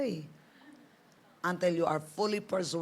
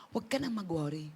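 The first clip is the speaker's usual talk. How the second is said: ordinary talk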